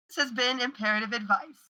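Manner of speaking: ordinary talk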